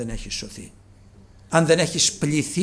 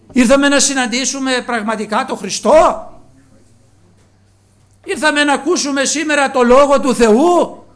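loud, rising sermon questions pressed on the flock; preaching at full force; 11 semitones higher, 13 dB louder than usual. Acted. Preacher asking the congregation why they came, loud preaching. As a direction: call out to the whole church, demanding an answer.

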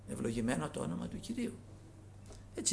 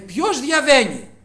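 slow loud call; 8 semitones higher, 15 dB louder, much slower than usual. Retold or imitated. Blind man calls out.

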